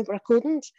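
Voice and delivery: normal talking voice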